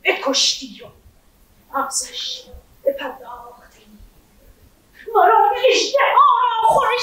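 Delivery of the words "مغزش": whispered. Acted. Hushed word in the tale.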